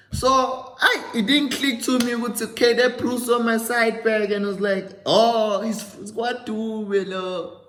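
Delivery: high, loud, excited disbelief; suddenly it all clicks, say it high and loud; shocked realization. Retold or imitated. Shocked realization retelling scam story.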